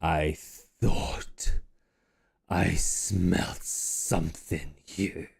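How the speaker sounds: low, slow rumbling dragon voice; menacing dragon. Acted. Dragon speaking to the party who entered its lair, low and slow. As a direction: drop low and savour each word like a beast.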